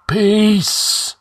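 high, long-held ringing command; drawn-out sepulchral cry, pitched far up; ghostly. A narrator's reading. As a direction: silence him like a spirit in a cavern. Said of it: "The ghostly tones reverberated".

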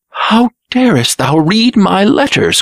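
high, shocked outcry; gasped. A narrator's reading. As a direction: gasp it out in alarm. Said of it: "gasped the Blue Prince".

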